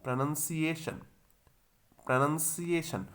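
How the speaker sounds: प्रोनंसिएशन said carefully as pre-nun-si-ay-shun; careful model word for students to repeat; model pronunciation. Shown as a demonstration. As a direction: say प्रोनंसिएशन clearly, the teacher's model. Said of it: "आपको इसको दो तीन बार बोलना है".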